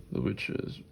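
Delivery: breathy spooky whisper of the title. Pretend. Vlogger whispers the movie title to camera, spooky hush. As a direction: hush it like a secret name.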